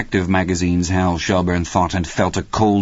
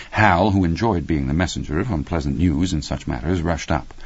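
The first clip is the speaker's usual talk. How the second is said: ordinary delivery, nothing marked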